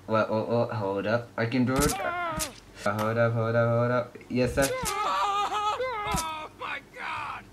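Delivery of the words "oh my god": very slow, high crying oh my god; drawn-out wail. Real, not acted. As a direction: stretch it into a wail. Dramatic game failure.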